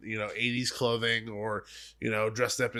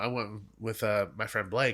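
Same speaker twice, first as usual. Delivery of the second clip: ordinary talk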